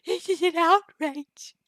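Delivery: high, loud, slow mock outrage; hammed-up shrieking comic indignation; Mighty Boosh quote. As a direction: blast it up high like a pompous cartoon. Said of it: "get used to my weird voices".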